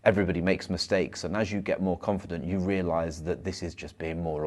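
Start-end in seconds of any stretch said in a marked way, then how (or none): none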